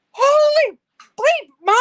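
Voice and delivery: put-on excited kid's shout. Son surfacing while snorkelling, shouting to mom in amazement. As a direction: yell it like a boy who just saw something huge. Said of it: "he goes".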